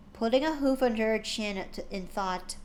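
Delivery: usual speaking voice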